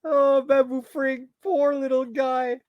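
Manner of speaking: high-pitched mock pity; amused pity. Real, not acted. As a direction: coo it high, fighting laughter. Laughing pity for the figure.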